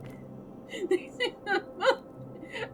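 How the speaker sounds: high squealing laugh-cry. Real, not acted. Gamer laugh-crying at the screen. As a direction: laugh and whimper at once.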